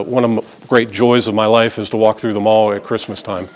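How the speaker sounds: dry, quiet sarcasm with a chuckle; softly mocking joy with a laugh; sarcastic. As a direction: say it like the last thing you'd enjoy. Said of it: "said sarcastically".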